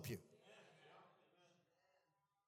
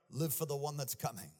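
ordinary talk

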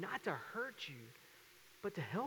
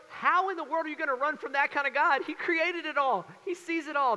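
high, louder awed alarm; panicked sailors; 9 semitones higher, 7 dB louder than usual. Retold or imitated. Sailors realising they can't outrun God, high alarmed voice. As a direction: pitch it up and push it out in alarm.

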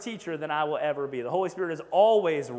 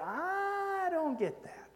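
high, slow puzzled whine; high drawn-out confusion; confused reader; 12 semitones higher, 6 dB quieter, much slower than usual. Retold or imitated. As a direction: sound baffled by the verse.

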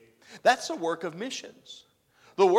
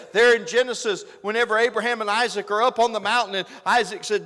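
ordinary talk, nothing marked

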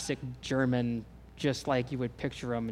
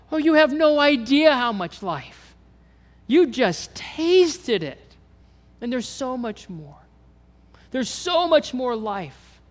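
high, loud, slow passionate proclamation; voicing God; 9 semitones higher, 9 dB louder, slower than usual. Preacher voicing God telling him there is much more life, loud and high. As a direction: cry it out joyfully as God.

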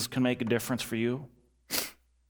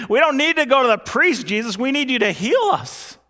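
desperate lepers' plea; 10 semitones higher, 6 dB louder than usual. Acted. Lepers protest to Jesus.

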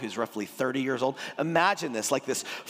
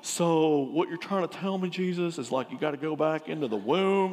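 mock dumb voice. Nicodemus wrongly imagined as dumb, lightly put-on dim voice. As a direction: play it a little dim and puzzled. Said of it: "he's not dumb. He's not going".

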